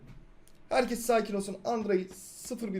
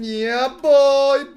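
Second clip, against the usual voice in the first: much louder, higher hype shout; triumphant cheer; 7 semitones higher, 13 dB louder than usual. Real, not acted. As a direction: celebrate the clutch loudly. Clutch win moment in game. (yüksek sesli zafer naraları)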